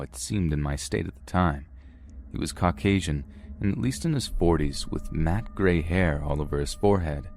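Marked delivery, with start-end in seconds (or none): none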